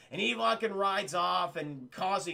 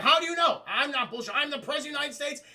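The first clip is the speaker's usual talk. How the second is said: mock outraged yell, higher and louder; indignant shout; 8 semitones higher, 7 dB louder, faster than usual. President protests to Will Smith at gunpoint, hammed-up indignant yell. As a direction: bark it like an insulted president.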